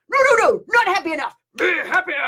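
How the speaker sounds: very high, insistent whine; mock demanding empath. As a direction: push the demand even further, whining. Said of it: "an empath has come up to you and say".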